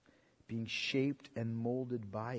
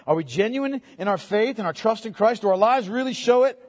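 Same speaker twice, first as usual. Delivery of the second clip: raised, louder preaching voice; fiery preaching; 9 semitones higher, 12 dB louder than usual. Acted. Preacher challenging the church, loud and urgent. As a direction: press the question on the congregation.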